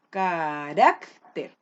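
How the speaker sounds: Carácter drawn out slowly, RÁC punched loud; stress on middle syllable. Shown as a demonstration. Teacher asking where the voice falls, RÁC stressed in Carácter. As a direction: stretch ca, then hit RÁC in Carácter. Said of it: "¿Y dónde se carga la voz?".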